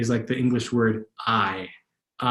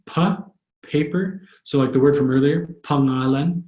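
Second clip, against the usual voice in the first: normal talking voice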